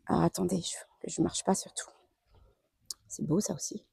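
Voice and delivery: soft whisper to herself. Real, not acted. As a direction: whisper as you step carefully. Stepping carefully among plants.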